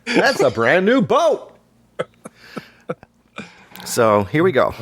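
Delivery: high, slow announcer put-on, far above his own pitch; wannabe broadcaster voice. Mocking wannabe broadcasters at the lake, slow high announcer voice. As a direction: sell a boat like a radio host. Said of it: "I go".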